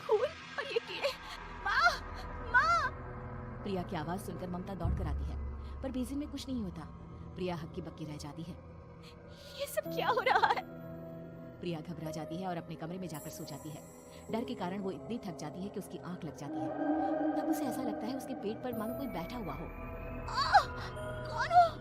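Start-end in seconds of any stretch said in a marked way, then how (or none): 0.1-2.9 very high horror, building to screams; panic, then scream for help; terrified cry. Acted. Priya finding blood and worms, screaming in terror. 9.6-10.6 put-on very high, distressed young voice; frightened bewilderment. Priya baffled by what is happening to her, frightened. 20.3-21.8 high, loud shriek of fright; terrified start. Acted. Priya feeling someone sitting on her belly, terrified.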